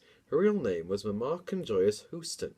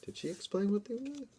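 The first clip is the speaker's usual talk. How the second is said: much higher, silly squeak; high squeaky voice; 12 semitones higher, 7 dB quieter, faster than usual. Pretend.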